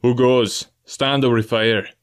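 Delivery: loud, slow warning call; hailing challenge. Acted. Captain hailing the buccaneer at the stockade, loud slow challenge. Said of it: "Then he hailed the buccaneer".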